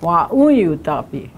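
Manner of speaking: "un" lifted and stressed in "wa, un, yu, ta, pi"; stress on second syllable. Shown as a demonstration. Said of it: "Second syllable has always got the emphasis".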